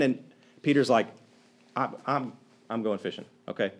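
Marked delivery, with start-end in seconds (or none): 1.8-3.7 hopeless. Retold or imitated. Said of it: "Peter is so hopeless".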